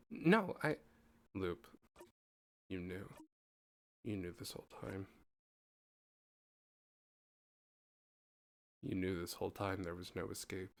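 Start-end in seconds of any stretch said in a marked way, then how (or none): 1.3-10.7 low, soft, hollow realization; devastated. Acted. Siffrin realizing Loop knew all along, low and hollow. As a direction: let it sink in quietly.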